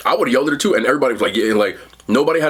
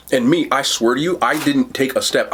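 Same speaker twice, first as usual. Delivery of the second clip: usual speaking voice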